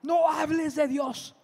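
amenaza airada en tono alto, contenida; grito imitado. Retold or imitated. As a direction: imita a una señora que manda callar, sin gritar de verdad. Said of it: "le empieza a gritar". (grito fingido de señora furiosa)

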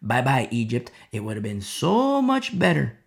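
ordinary talk

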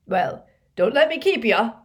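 loud, high, falsely breezy brush-off; forced lightness, too loud; unconvincing airiness. A narrator's reading. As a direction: act casual while eager to be gone. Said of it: "most unconvincing stab at airiness".

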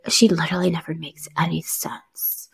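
half-whispered imitation of a snide aside; hushed mock jab under the breath; snarky under-breath comment. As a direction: mutter it softly, mimicking a catty colleague. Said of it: "snarky comments under their breath".

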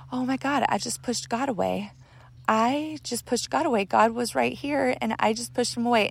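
high, hushed disbelief; stunned regret. Retold or imitated. Reliving realization she rejected God.

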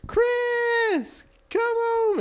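high, long calling yell across the street; mom calling out. Retold or imitated. Mama calling her son home from down the street, long high call. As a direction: call him home from far away like mama. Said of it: "my mom would yell".

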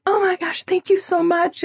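high-pitched, overwhelmed thanks; gushing gratitude. Retold or imitated. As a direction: gush it, voice leaping way up. Retold gushing thanks to clerk.

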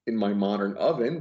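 normal talking voice

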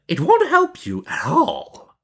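slow, high, creaky witch voice; scolding witch. A narrator's reading. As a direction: scold the girl as a nasty old witch. Witch scolds the weeping girl.

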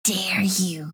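mock-offended whisper.

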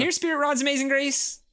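high sing-song call to a dog. Retold or imitated. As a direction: call the dog home from across the park. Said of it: "Maybe someone actually does call".

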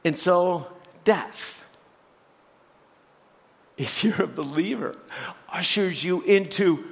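normal talking voice

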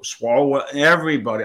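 ordinary talk, nothing marked

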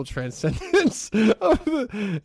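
laughing through the words slowly; chuckling, stretch it out; mocking the headline through a laugh. Real, not acted. Mocking the article's word.

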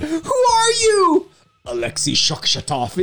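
high, drawn-out cry then a normal-pitched name; hammed-up comic-book confrontation; mock superhero showdown. Co-host playing out comic book episode six, hammed-up dramatic cry.

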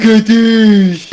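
high, loud catchphrase yell; shouted exclamation. Acted. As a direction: shout it triumphantly. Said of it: "that sound means".

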